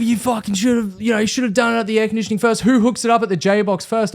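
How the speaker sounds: high, agitated telling-off; retold indignant scolding; having a go. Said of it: "had a massive go at me".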